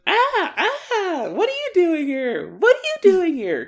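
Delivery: high, loud startled squeals; mock panicked shrieking. Friends startled in the bushes outside, mock panicked shrieking.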